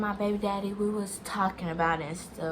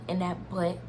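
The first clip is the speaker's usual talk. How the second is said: normal talking voice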